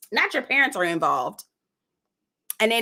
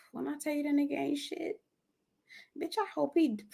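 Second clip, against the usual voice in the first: hushed, higher, trailing off mid-curse; holding back outrage; 5 semitones higher, 11 dB quieter, faster than usual. Real, not acted. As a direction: say it under your breath, stopping yourself. Biting back cursing about the man.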